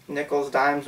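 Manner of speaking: ordinary talk, nothing marked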